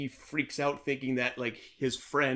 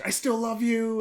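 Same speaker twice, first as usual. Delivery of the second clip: put-on kid shouting; 10 semitones higher, 4 dB louder than usual. Kid grabs mic, shouts.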